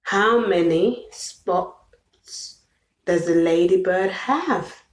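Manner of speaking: put-on drawn-out, slow question for a child; slow, sing-song question to a toddler. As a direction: stretch each word out slowly. Repeats question slowly, then fast.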